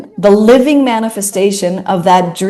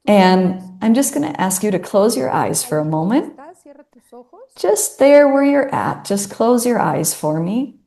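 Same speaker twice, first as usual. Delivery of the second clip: guided visualization. Acted. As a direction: gently lead them into closing their eyes. Starts guiding a visualization.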